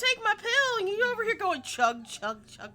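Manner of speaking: high, loud comic scolding; mock outrage. Player scolding someone over stolen juice, high mock-angry yell. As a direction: yell it high in playful indignation.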